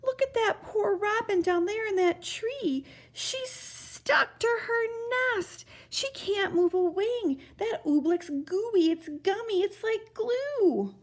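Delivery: put-on high, slow, upset young Bartholomew; a boy pained by the stuck robin; distressed boy. A narrator's reading.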